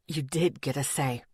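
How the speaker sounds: low, soft male voice; deep cracking voice. A narrator's reading. Boyfriend corrects Cassie, deep and soft. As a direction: correct her quietly, deep. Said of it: "his deep voice cracking in the middle".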